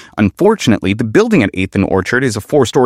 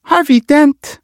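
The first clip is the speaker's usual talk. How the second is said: much higher woman's voice, rising on Harvey; female officer voice; slower than usual. Acted. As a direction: voice Officer Ramirez up high, questioning.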